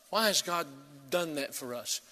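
ordinary talk, nothing marked

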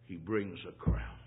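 very low, soft preacher's voice; hushed solemn preaching. Real, not acted. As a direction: drop right down, grave and reverent.

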